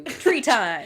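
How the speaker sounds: excited shout. Acted. Calling out the tree power.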